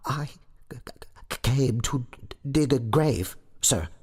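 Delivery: high, halting stammer; frightened stammer. A narrator's reading. Terrified sexton answers the goblin, stammering. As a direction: trip on the consonants in a high, scared voice. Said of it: "stammered Gabriel Grubb".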